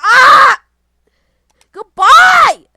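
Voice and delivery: high, very loud shriek; screaming. Real, not acted. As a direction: scream out your farewell in distress. Screaming as game goes wrong.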